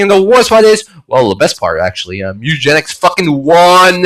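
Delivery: ordinary talk, nothing marked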